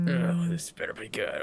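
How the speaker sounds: sleepy husky morning tone; sexy morning voice. Real, not acted. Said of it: "Zachary with a sexy voice after the morning".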